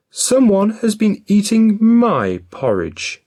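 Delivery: high, loud mother bear voice; middle-sized Mother Bear voice. A narrator's reading. Mother Bear finding her spoon in the bowl, high and loud. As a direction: pitch it up, a fussy mother. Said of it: "she said in a middle-sized voice".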